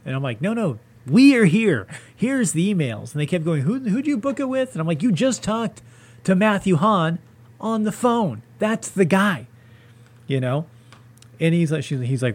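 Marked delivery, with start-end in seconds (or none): 0.4-3.1 higher, louder exasperated insistence; indignant retelling of an argument; retold insistent protest. 3.6-4.7 high-pitched mocking imitation of staff; high, dopey mock question; mock clueless staffer. Voicing the clueless venue staff. 5.0-9.4 higher, emphatic exasperated explanation; indignant reply, pitched up; retold exasperation.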